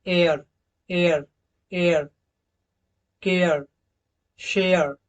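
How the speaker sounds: each word with a clear एआ glide; model words. Shown as a demonstration. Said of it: "इस word को देखिए आप".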